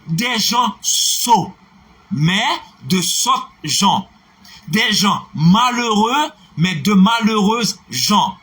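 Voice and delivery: each pair slowed, noun then adjective set apart; slow model contrasts. Shown as a demonstration. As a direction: slowly recite the contrasting pairs. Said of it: "l'adjectif est placé après Jean".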